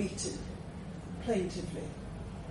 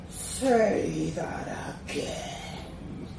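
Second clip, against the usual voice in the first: very slow, low menacing growl; dragon's drawn-out quiet demand; 4 semitones lower, 4 dB quieter, much slower than usual. A narrator's reading. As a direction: draw it out slowly, low and threatening. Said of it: "she demanded".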